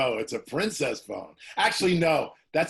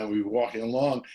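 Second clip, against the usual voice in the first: ordinary talk, nothing marked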